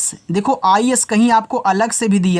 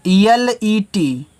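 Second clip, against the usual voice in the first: slow, deliberate letter-by-letter spelling; letters spelled out slowly; much slower than usual. Shown as a demonstration. Teacher spelling an English word for children, slowly. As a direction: spell each letter apart for the class. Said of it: "teacher spells word letter by letter".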